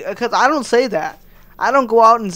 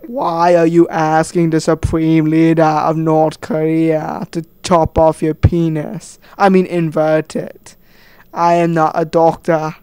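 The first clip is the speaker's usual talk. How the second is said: slow, loud, lower exaggerated dictator voice; Kim Jong-un impression; 3 semitones lower, 6 dB louder, slower than usual. Kim Jong-un slowly and loudly refuses the surgery. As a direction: ham up the indignant tyrant, slowly. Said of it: "Kim Jong-un would say".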